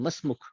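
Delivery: مسمک said as one quick word; quick joined form. Shown as a demonstration. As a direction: clip مسمک together fast. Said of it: "اب وہ تیزی سے پوچھے گا". (مسمک تیزی سے ملا کر)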